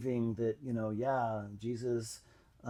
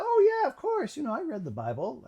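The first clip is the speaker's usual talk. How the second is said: much higher, breezy fake confidence; mock confident bluff; 12 semitones higher, 5 dB louder than usual. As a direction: act like you know it all. He bluffs knowing the Bible.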